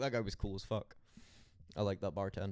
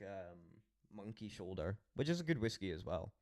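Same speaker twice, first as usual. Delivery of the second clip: ordinary delivery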